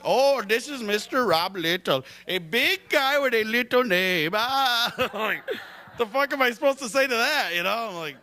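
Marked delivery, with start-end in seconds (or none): 0.1-4.3 put-on teasing Indian accent. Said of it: "Always teasing me".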